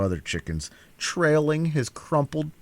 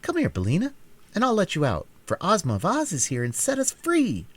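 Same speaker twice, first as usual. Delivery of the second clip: put-on calling girl; 9 semitones higher than usual. Dorothy calls her hen.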